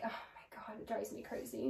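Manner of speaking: very soft, low, worn-out grumble; exasperated undertone. Retold or imitated. Retelling her reaction to her sister's arguing, exasperated undertone. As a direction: grumble it almost under your breath.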